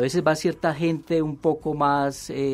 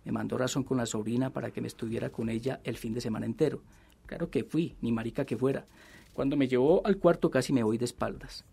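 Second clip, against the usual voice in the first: measured novel reading, softer than the chat; story narration; 5 dB quieter, faster than usual. A narrator's reading. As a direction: read the confession aloud as a storyteller. Reads novel excerpt aloud.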